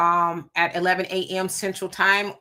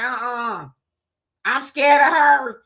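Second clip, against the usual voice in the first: slow, higher put-on frightened voice; mock scared hurricane; 5 semitones higher, 5 dB louder, slower than usual. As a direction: play the hurricane backing off in fake fear. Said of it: "Hurricane Melissa was like".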